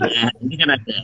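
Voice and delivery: normal talking voice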